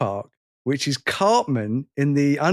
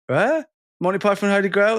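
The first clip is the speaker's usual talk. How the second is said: baffled; 6 semitones higher than usual. Retold or imitated. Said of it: "they'll go".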